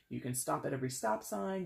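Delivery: ordinary talk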